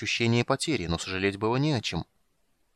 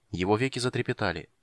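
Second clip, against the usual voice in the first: usual speaking voice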